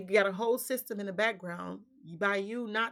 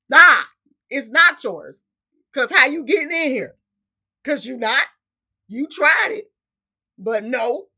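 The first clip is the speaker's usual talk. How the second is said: defiant retort; 4 semitones higher, 11 dB louder than usual. Retold or imitated. Retorting to the imagined intruder.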